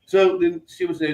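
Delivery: ordinary talk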